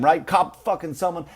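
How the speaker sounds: ordinary delivery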